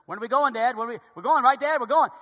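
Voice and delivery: imitating excited kids pestering Dad; eager kids.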